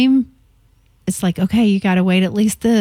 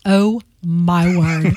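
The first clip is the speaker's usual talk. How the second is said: slow, drawn-out oh my word; exasperated; 3 semitones lower, much slower than usual. Retold or imitated. Reacting to a friend's dictated ellipsis, slow exasperation. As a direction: sigh it out in disbelief. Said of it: "I'm like".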